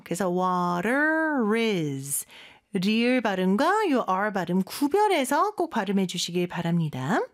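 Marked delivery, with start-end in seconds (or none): 0.3-2.3 "water is" drawn out, T as a quick tap; tapped T, linked R. Shown as a demonstration. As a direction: slowly say "water is" with a flapped T and linked R. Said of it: "T 발음은 리을처럼 발음이 돼요".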